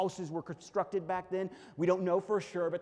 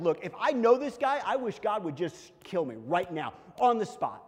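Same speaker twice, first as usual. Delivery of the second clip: higher, louder emphatic vow; dead-serious denial; 4 semitones higher, 5 dB louder than usual. Acted. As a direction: swear it forcefully, acting out Peter. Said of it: "He is serious about that denial".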